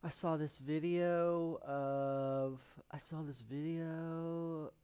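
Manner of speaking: put-on drawn-out Valley Girl vowels; slow, stretched Valley Girl drawl. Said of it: "Stop talking in Valley Girl voice".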